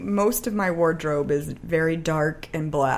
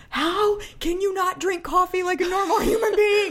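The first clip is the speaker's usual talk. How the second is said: exasperated husband; 11 semitones higher than usual. Retold or imitated. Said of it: "He's like".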